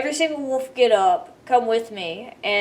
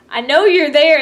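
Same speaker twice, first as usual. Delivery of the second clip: louder teasing call; 3 semitones higher, 7 dB louder than usual. Retold or imitated. Player calling out to the hiding friend, loud teasing call.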